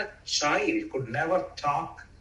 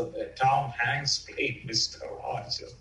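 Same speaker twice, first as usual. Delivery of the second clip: normal talking voice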